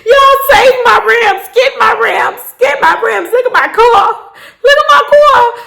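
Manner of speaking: high, hammed-up anguished cry; mock wailing. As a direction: wail for help to save your precious rims. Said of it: "that's what I felt like".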